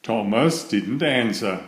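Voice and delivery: ordinary delivery, nothing marked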